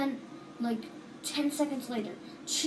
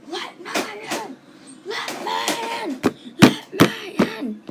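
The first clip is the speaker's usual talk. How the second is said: high, slow, growing monster shout; monster banging to get in; 11 semitones higher, 8 dB louder, slower than usual. Retold or imitated. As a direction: pound on the glass and demand entry. Bonnie pounding on the glass.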